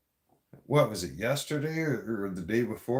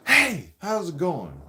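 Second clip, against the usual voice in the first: slow, loud, in-your-face hey; loud greeting; 4 semitones higher, 5 dB louder, slower than usual. Shown as a demonstration. Demoing a rude greeting to the viewer, loud and slow. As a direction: bark the greeting right at their face, drawn out. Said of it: "Kind of like that".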